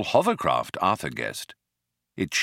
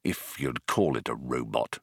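low, soft grumble; quiet, low-pitched dismissive aside; muttered; 9 semitones lower, 6 dB quieter than usual. A narrator's reading. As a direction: mutter it under the breath. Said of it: "muttered Arthur".

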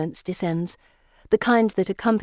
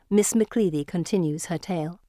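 usual speaking voice

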